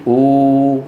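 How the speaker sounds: أو as a long held oo, no closing stop; long vowel. Shown as a demonstration. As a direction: stretch أو into a steady long oo. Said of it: "بدلا منها بيقولوا". (مدّ «أو» واوًا طويلة)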